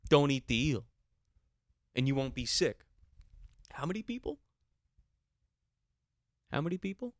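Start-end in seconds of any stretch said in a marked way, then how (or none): 3.7-7.1 high-pitched disbelief; incredulous squeal. Real, not acted. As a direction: ask it up high, stunned. Incredulous rant about eel.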